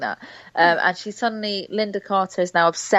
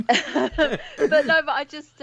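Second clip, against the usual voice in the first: higher, laughing through the words; 9 semitones higher, much slower than usual. Real, not acted. Guest recovering from the co-host's quip, laughing. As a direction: try to carry on through a laugh.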